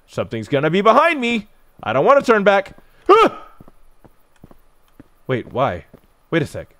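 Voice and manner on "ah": high loud jump-scare scream; sharp frightened yelp; startled scream. Real, not acted. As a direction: shriek at the sudden scare.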